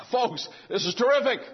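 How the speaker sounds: high, laughing preacher's exclamation; hammed-up delight at great news; exuberant imitation. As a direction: play up the prophet's excitement with a laugh. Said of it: "doesn't say it like that".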